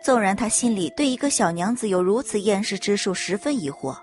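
ordinary delivery, nothing marked

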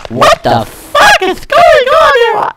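very high, squealed disbelief; shocked outburst. Real, not acted. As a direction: shriek it in sudden shock. Shock at in-game mishap.

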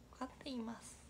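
whispered repeat of the dialect term. Shown as a demonstration. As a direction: whisper 放課って言います. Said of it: "repeats dialect word whispered".

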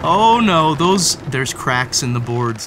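usual speaking voice

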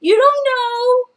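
high, loud playful comeback; playful retort. Retold or imitated. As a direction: tease back at husband. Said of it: "I was like".

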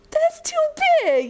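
very high sing-song objection; playful protest. Real, not acted. Mother joking about an elephant, high playful protest.

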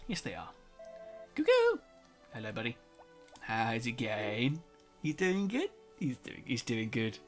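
ordinary delivery

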